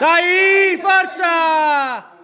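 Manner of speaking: high, loud gym shout; shouting encouragement. Real, not acted. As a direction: yell to push them through. Final push, cheering athlete on.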